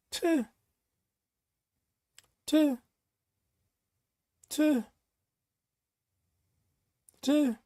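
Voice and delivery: short, high, soft scoff sounds; scoffing tuh. Real, not acted. Viewer tells her not to complain, soft scoffing tuh. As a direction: scoff in disbelief at the advice.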